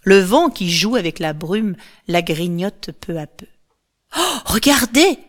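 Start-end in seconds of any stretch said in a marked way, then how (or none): 4.2-5.2 higher-pitched exclamation; excited cry. A narrator's reading. Andromache spots something in the fog, crying out. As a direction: call out in wonder. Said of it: "s'écrie à nouveau Andromaque".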